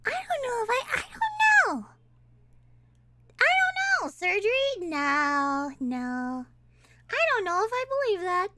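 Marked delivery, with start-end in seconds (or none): 0.1-4.1 high-pitched baffled squeak. Real, not acted. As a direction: voice shoots way up in doubt. Doubts the clip, squeaky baffled answer.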